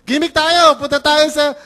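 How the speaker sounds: high, loud eager youth; young people keen to hang out; eager invite. Retold or imitated. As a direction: pitch it like a thrilled teen.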